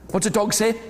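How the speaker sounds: plain reading voice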